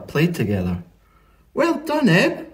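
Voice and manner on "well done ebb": high, excited praise; much higher, cheering Flo; pleased Flo. A narrator's reading. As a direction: praise the dog in a lifted, lively voice. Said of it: "said Flo".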